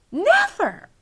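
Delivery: put-on loud, high-pitched girl's refusal; indignant cry. A narrator's reading. Sophie refuses the giant's offer, crying out indignantly. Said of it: "cried Sophie".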